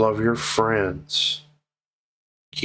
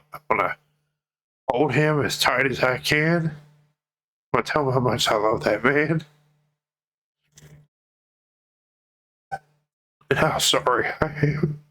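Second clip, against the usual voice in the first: real tearful voice, pitched higher; choking up with love and regret; crying; 6 semitones higher than usual. Real, not acted. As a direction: voice lifting as the tears come. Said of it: "I'm sorry for crying".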